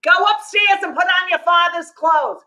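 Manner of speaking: high, called-out mock motherly order; mother's voice. Mother sends tall daughter upstairs, high impression of mother. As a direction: send the child off, pitched high.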